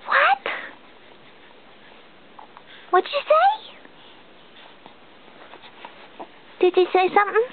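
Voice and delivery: high delighted surprise; surprised. Real, not acted. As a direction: squeal it in sudden delight. Surprised baby made sound.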